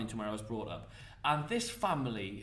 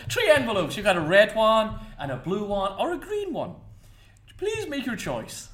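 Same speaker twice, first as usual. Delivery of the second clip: high-pitched stage Irish accent; hammed-up Irish patter for an audience; 6 semitones higher, 5 dB louder than usual. Shown as a demonstration. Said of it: "line from one of your effects in this Irish accent".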